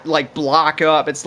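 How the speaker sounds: usual speaking voice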